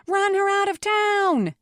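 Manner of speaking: very high, loud cry; mob yell. A narrator's reading. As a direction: yell it to rouse a crowd. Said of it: "someone yelled".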